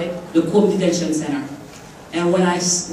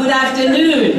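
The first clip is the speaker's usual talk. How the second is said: louder, higher call to wake the room; rousing greeting; 6 semitones higher, 4 dB louder than usual. Real, not acted. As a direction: call it out so the crowd answers back. Rousing a sleepy audience to answer.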